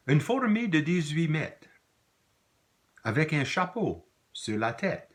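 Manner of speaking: high, emphatic poem reading; poem recitation. Acted. Teacher reading Desnos's ant poem to students, lively recitation. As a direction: recite it with big swooping emphasis and pauses.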